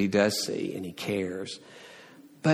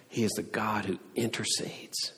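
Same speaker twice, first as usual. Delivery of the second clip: whispered; 9 dB quieter than usual. Real, not acted. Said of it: "unvoiced whisper".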